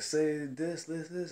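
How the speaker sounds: high, lightly put-on manager voice; imitated manager coaching. Manager showing a young worker what to say, imitated coaching. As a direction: pitch it up, not actually yelling. Manager demonstrates yelling to him.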